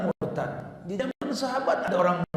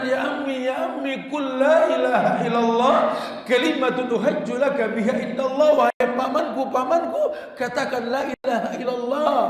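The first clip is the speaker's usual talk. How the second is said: raised voice, urgently begging his uncle; loud pleading; 6 semitones higher, 9 dB louder than usual. Acted. The Prophet begging his dying uncle to believe, loud urgent pleading.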